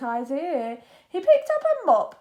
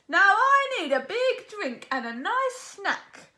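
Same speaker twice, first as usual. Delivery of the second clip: captain's voice; 6 semitones higher than usual. A narrator's reading. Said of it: "he said".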